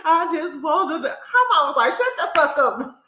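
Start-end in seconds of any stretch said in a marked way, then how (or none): 0.1-1.1 high, tearful whine; hammed-up weepy voice, pitched up; mock crying. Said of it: "she started crying like". 1.9-3.0 high-pitched mock snap; amused imitation of a scolding; retold angry outburst. Acting out mama snapping at Wendy.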